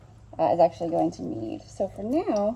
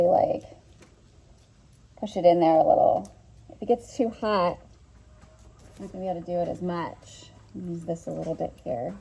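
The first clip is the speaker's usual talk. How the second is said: muffled behind a mask; through a face mask. Real, not acted. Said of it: "I'm gonna have to talk through a mask".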